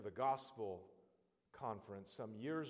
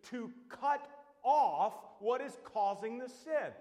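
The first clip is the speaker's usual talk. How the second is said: full-force preaching; 7 semitones higher, 7 dB louder than usual. Acted. Preacher warning a sinner at the cliff edge, loud and urgent. Preaching Jesus' words on lust.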